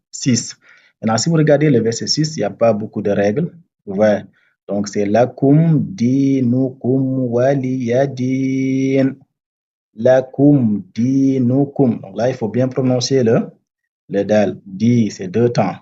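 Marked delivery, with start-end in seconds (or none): 5.0-12.0 slow, drawn-out chanted recitation; Quranic recitation. Shown as a demonstration. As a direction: recite it slowly, stretching the vowels. Teacher recites verse as model.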